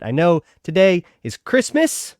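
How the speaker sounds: ordinary talk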